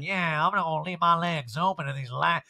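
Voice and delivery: slow put-on drawl; loose, hammed-up impression; Erica. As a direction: drag out the vowels, slightly high. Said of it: "she's, like, man spreading and like".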